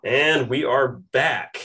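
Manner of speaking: slow, drawn-out announcer welcome back; slow, mock-grand return from the break; host return announcement. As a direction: stretch it out like a radio host. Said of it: "show return announcement".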